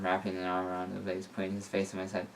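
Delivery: usual speaking voice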